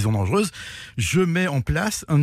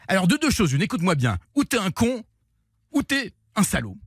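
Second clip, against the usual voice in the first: higher, hard-edged mock menace; imitated threatening Tapie; 7 semitones higher than usual. On the phone, Tapie threatens the journalist: mock angry menace. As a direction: lay down the ultimatum like an angry boss.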